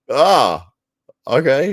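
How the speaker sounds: high, loud caught-off-guard okay; startled okay. Retold or imitated. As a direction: act suddenly thrown in. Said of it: "I'm like".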